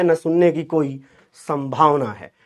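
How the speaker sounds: ordinary delivery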